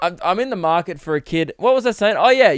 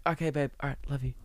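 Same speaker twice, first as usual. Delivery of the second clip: imitating a hushed, much softer phone goodbye; furtive sign-off; 12 dB quieter, faster than usual. Imitating mates hiding love-you from friends, furtive hushed sign-off. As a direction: mimic someone trying to slip it out unheard. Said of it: "they try and whisper it".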